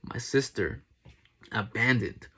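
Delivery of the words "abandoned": usual speaking voice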